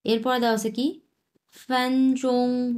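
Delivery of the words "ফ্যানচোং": ফ্যানচোং held level and high on both syllables, slowly; drawn-out flat first-tone ফ্যানচোং; Chinese first tones. Shown as a demonstration. Teaching students a Chinese word: slow, level tones. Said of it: "দুটাই এক number tone".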